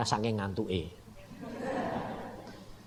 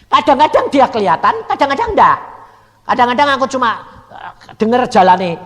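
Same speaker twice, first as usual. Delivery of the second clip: preaching; 13 semitones higher, 14 dB louder than usual. Acted. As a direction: drive the warning home from the pulpit. Preaching at full force. (khotbah lantang dan lambat)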